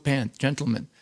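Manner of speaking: usual speaking voice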